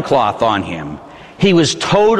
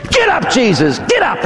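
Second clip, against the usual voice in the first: high, jumping higher on the repeat; acted harsh command; 8 semitones higher than usual. Soldier standing over fallen Jesus before kicking, high harsh command. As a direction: order the fallen prisoner up.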